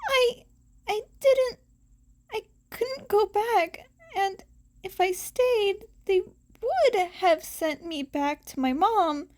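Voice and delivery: the words break as he fights back tears; tearful boy. A narrator's reading. Boy explaining to friends why he ran away, tearful.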